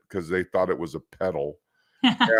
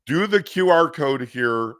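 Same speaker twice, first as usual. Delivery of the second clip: ordinary talk, nothing marked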